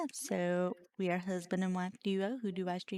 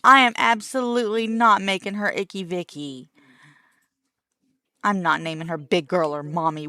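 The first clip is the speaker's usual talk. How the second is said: emphatic loud refusal; 11 dB louder than usual. Real, not acted.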